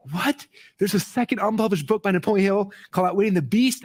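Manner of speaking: high-pitched stunned disbelief; freaking out. Retold or imitated. Hearing about a second unpublished Hill book, stunned high disbelief. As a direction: squeal the question in shock. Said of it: "I was freaking out".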